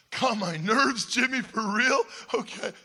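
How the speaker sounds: put-on be his wide-eyed eleven-year-old self; a kid hanging on the coolest guy's words; eager little kid. Naive boy answering cool Jimmy.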